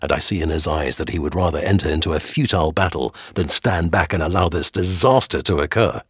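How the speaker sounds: ordinary delivery, nothing marked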